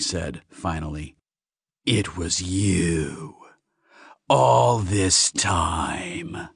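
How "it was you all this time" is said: very slow, drawn-out realisation; slow, seething accusation; dawning accusation. Acted. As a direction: stretch every word with simmering menace. Alien realises who insulted him.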